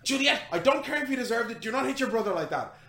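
fast, loud scolding with a growl; a mother growling at her children at the table; mother's growl. Acted. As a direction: snap at the kids like an angry mother. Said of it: "there's nearly a growl in the mother's voice".

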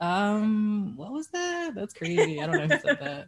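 high-pitched mock innocent denial; hammed-up "wasn't me" voice; feigned innocence. Acting innocent when friend comes.

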